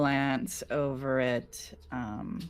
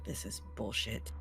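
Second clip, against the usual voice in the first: muttered under breath; 2 semitones lower, 9 dB quieter than usual. Acted. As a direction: grumble it softly under your breath. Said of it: "can be clearly heard to mutter under her breath".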